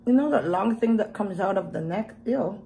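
talking while licking fingers; muffled by fingertips at lips; fingers in mouth. Real, not acted. Seen 2: Fingers pressed between lips at 2.2-2.8 and 4.7-5.3; off between.

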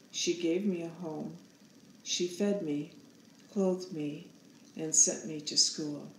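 usual speaking voice

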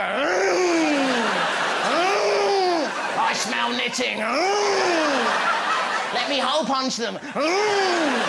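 loud, hungry sniffing monster voice; hammed-up car monster growling with relish; predatory car. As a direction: savour the scent like a hunting beast. Herbie the car sniffing pensioners.

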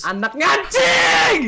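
high, very drawn-out howl; long high-pitched cry of betrayal; outraged scream. Real, not acted. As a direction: wail the curse on learning you were tricked. Howls after learning he was tricked.

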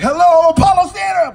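high, booming holler to a crowd; big stage greeting. Acted. Comic greeting the Apollo Theater, hollering. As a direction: yell it like a headliner's opening, drawn high and loud.

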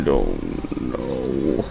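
extremely slow, deep-voiced uncertainty; very low drawl. Gamer unsure of the code, very low drawl. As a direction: drop deep and stretch it out.

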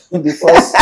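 laughs through a high giggle; giggling as she starts the joke; amused laugh breaking in. Real, not acted. Laughing at long-ago date.

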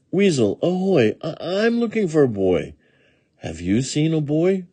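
high-pitched puppy voice calling out; eager puppy. A narrator's reading. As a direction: ask like a pup searching hard. Puppy figure in story.

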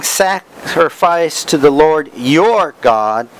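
slow quote, leaning hard on YOUR; stress on YOUR. Shown as a demonstration. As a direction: draw it out and single out YOUR. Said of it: "by saying".

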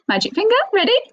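high, bright call to get ready; rally the class with a big excited ready. Shown as a demonstration. Teacher to children before drawing, excited high call. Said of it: "teacher hypes the children".

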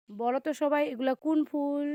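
calling out. Real, not acted. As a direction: shout the question across the garden. Repeats question, calling out loud.

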